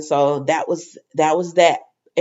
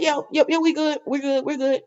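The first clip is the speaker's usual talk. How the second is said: high, softer reassuring voice, much higher; lightly voiced Brooklyn; 8 semitones higher, 4 dB quieter than usual. Brooklyn answering Chase, are we good, in a high soft voice. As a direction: brush it off gently, pitched up.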